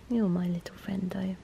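soft tender whisper. Real, not acted. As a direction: whisper it close to a sleeping cat. Whispering to the cat.

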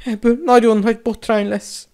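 very high, distressed wail; panicked stammer. A narrator's reading. A pupil dreading a huge scandal, panicked stammer. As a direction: fret aloud, dreading the consequences.

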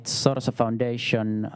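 ordinary talk, nothing marked

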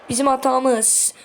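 ordinary talk, nothing marked

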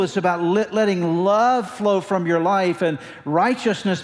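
loud, high-pitched, driving sermon delivery; full-force preaching. Real, not acted. Preacher contrasting rules with love to the congregation, full-force preaching. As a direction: preach it out loud and high, hammering the key words.

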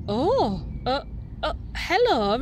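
startled stammer. Acted. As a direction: caught off guard, stumbling. Nora startled by polar bear.

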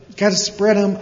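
high-pitched acted urging; pressing owl mid-dive; urgent insistence. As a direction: keep pushing the frightened one. Owl urges falling eaglet.

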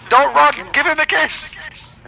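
high, loud and drawn-out tease; sing-song holler at a fleeing mate; high teasing yell at a teammate; teasing high shout. Pretend.

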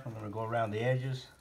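ordinary delivery

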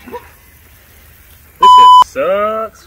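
stretched out, loud in real pain; pained loud groan. Real, not acted. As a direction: drag it out in burning pain. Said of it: "I'll have to bleep out the swear word".

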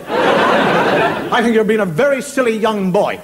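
loud, slow mock reprimand; acted indignant grown-up on the phone; stern telling-off. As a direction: spell out disapproval to a naughty child. Adult scolds prank caller.

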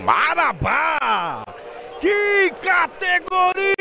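drawn-out screaming goal call; explosive goal commentary. Real, not acted. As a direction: yell the goal, high and stretched. Goal scored, live narration.

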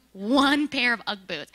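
One punched loud, slowly, One pair of Ugg boots; stress on One. Shown as a demonstration. Telling the audience the ten-hour call sold only boots, One stressed loud.

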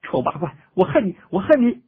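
tearful cry rising to a high, loud scream. A narrator's reading. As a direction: sob out the hurt, then shriek. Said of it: "掩面哭道".

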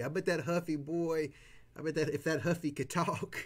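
ordinary delivery

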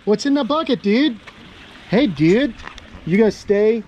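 high sing-song talk to a pet. Real, not acted. Baby talk to pet lizard.

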